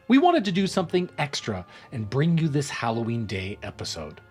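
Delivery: ordinary delivery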